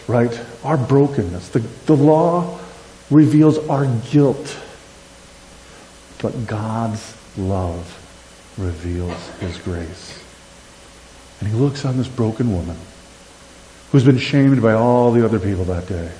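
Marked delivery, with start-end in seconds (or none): 6.2-10.2 soft, slow and low; a soft, reverent aside; tender hush. Real, not acted. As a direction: let the grace land gently. Quiet tender turn after the law.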